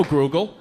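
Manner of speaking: "Grugel" for Google, with an added R; extra R. Retold or imitated. Comic mimicking dad's word for Google, extra R in "Grugel". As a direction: slip an R after the G in "Grugel". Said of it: "adding R's into words that don't need R's".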